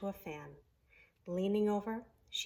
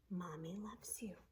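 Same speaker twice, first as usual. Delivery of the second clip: soft unvoiced whisper of a mother's words; 2 semitones lower, 12 dB quieter than usual. A narrator's reading. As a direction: breathe it like a remembered voice.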